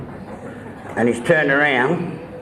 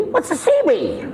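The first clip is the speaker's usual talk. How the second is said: high puzzled question; baffled patient. Retold or imitated. Patient asking the doctor, high and baffled.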